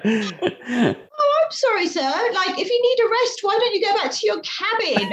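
high, bright mock-innocent apology; naive chirpy reply. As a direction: play the clueless new purser, sweetly eager to help. Retelling her naive front-desk reply.

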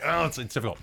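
ordinary talk

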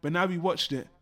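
usual speaking voice

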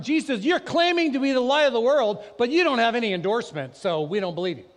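loud, high, scornful challenge; mocking Pharisees. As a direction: sneer at him as the critics. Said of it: "The Pharisees said to him".

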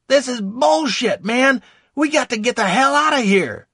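loud, high panicked bellow. Acted. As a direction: bellow it over the battle. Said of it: "bellowed to no one in particular".

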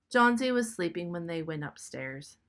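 ordinary delivery, nothing marked

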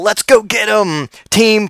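slow, high rallying cry; buddy rallying friends, hammed-up hero shout; eighties action hero. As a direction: pump the crowd like an eighties hero. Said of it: "kinda like nineteen eighties style".

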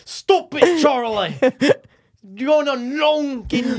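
high-pitched mock British accent; hammed-up British scolding. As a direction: put on a high, loud British voice. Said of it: "is that British?".

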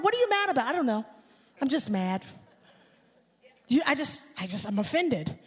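sulky, low mumbling voice of an offended person; a hint of a sulky complainer; mock offended person. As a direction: play the grumpy offended one, mumbled. Mocking offended people, dialogue.

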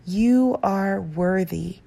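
slow, deliberate affirmation; slow mantra. Shown as a demonstration. Repeating a self-love mantra slowly to herself. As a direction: let each word land slowly. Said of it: "other mantras that are empowering to you".

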